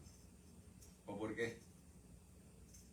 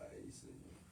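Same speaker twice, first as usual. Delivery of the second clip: soft, low, sorrowful confession; regretful lament; 4 semitones lower, 7 dB quieter than usual. Retold or imitated. Believer confessing to God after the man died, soft and sorrowful. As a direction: sigh it out with guilt.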